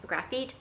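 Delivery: "Graphite" said graf-EET, rising in question; "Graphite" ending like "feet"; unsure pronunciation attempt. Shown as a demonstration. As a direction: say "Graphite" with an EE in the last syllable, unsure. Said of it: "I don't know how to pronounce it".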